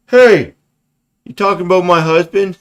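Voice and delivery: loud, high indignant wife; offended wife. Retold or imitated. Wife in the audience snapping back, loud and high. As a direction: bristle like a wife defending her husband. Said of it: "somebody in the audience that's gonna be like".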